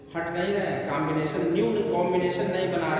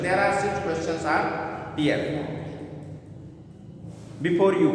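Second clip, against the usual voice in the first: slow emphasis; much slower than usual. Shown as a demonstration. Teacher stresses six questions.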